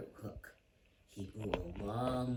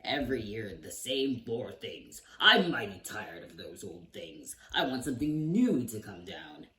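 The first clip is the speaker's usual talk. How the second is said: demanding king; 7 dB louder than usual. Acted. King demands something new.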